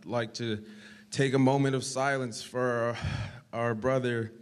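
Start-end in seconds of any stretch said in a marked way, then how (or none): none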